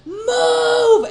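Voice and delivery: high, loud screamed warning; sudden full-voiced panicky yell; retold scream. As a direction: scream it out to clear everyone away. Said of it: "And I'm screaming".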